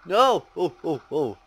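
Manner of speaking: high loud excited shrieks; excited yelling. Real, not acted. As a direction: yelp with sudden tension. Tense jump in game.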